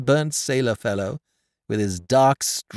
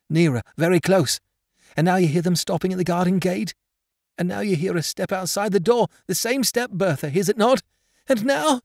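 rising, higher, agitated excitement; breathless anticipation; 6 semitones higher than usual. A narrator's reading. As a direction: build the suspense as each sound comes nearer. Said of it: "she panted".

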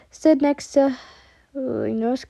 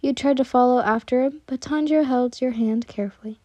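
plain reading voice

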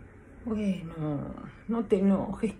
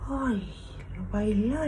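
usual speaking voice

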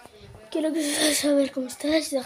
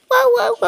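dog bark imitation; 8 semitones higher, 7 dB louder than usual.

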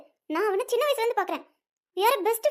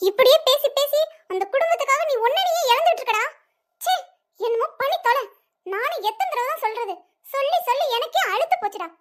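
higher-pitched, fed-up outburst; exasperated scolding; 4 semitones higher than usual. Acted. Parent fed up with son's excuses, exasperated scolding. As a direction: scold as if tired of repeating it.

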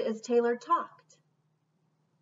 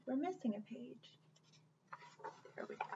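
plain reading voice

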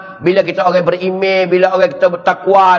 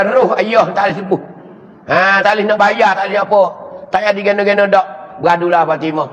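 ordinary talk, nothing marked

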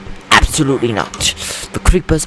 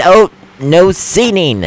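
mock scolding; 8 semitones higher, 5 dB louder, slower than usual.

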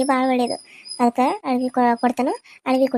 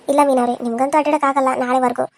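normal talking voice

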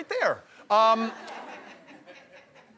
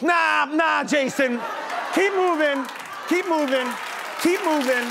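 mock heckling shout; 7 semitones higher, slower than usual. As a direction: imitate a rowdy friend shouting him away. Drunk friend heckles Bateman.

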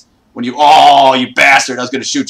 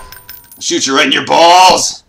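normal talking voice

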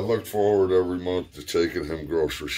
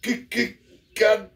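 high, louder stammering cuss; angry stuttering uncle; 3 dB louder than usual. Retold or imitated. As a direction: stammer then blurt it out, mock angry old man. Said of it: "He goes".

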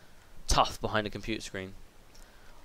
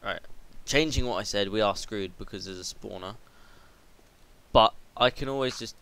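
ordinary delivery

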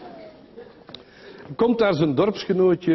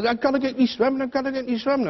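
put-on whiny child; 9 semitones higher than usual. Basiel whimpers he can't swim.

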